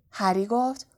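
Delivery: ordinary delivery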